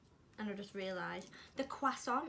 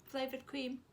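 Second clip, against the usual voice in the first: higher, bright package-copy read; reading a label; 5 semitones higher than usual. Acted. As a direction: read it like an advert. Reads the product description aloud.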